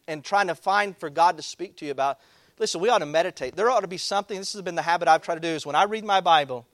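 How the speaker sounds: usual speaking voice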